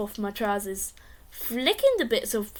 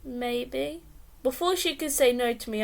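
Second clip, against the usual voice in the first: ordinary delivery, nothing marked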